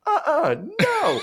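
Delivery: very high, loud laughing no; panicked refusal. Retold or imitated. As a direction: yelp it, recoiling in horror. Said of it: "He's like".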